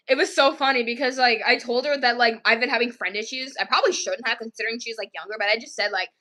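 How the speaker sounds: ordinary delivery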